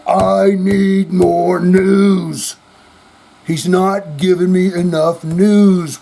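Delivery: a listener whining for news, drawn out; mock screaming fan. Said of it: "fucking screaming".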